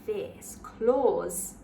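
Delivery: put-on lively read-aloud for children; story narration. A narrator's reading. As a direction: read it like a bedtime picture book. Picture book read aloud.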